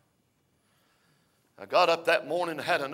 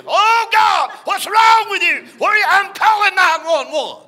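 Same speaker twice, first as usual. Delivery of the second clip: much higher, shouted panic; panicked wife; 16 dB louder than usual. Retold or imitated. Wife finds husband on the floor, panicked high shout. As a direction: shriek it in alarm.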